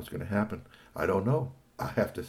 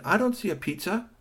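high chirpy little robot voice; lightly voiced cheerful robot; 12 semitones higher than usual. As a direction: squeak it out like a tiny robot.

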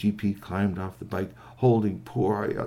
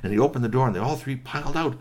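ordinary delivery, nothing marked

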